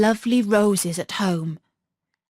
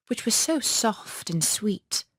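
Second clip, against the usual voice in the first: ordinary delivery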